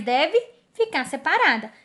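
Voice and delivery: ordinary talk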